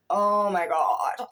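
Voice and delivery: drawn-out, slow "oh my God"; dawning realization. Retold or imitated. Reader recognizes herself in the book, slow dawning realization. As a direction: let the retold realization land slowly.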